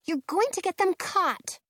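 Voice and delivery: put-on scolding girl. A narrator's reading. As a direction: scold them urgently. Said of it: "Penny says".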